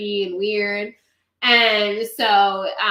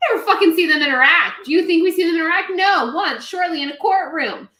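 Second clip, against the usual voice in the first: loud, fast exasperated rant; 6 semitones higher, 4 dB louder than usual. Real, not acted. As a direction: vent it, fed up and fast. Ranting about missing bond.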